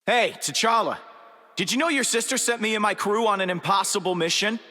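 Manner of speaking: high-pitched, rapid-fire grievance; indignant complaint. Acted. Crew leader confronting T'Challa over the mission, indignant. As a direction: call him out, high and fast.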